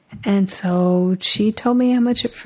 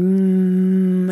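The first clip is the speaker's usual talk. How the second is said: held closed-lip "mm"; imitated baby hum. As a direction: hum a long, level "mm" like a baby. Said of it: "you were making a sound".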